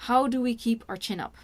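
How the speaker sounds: ordinary talk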